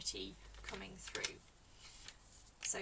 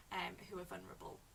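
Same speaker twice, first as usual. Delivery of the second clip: ordinary delivery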